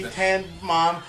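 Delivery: ordinary talk, nothing marked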